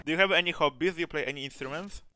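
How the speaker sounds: normal talking voice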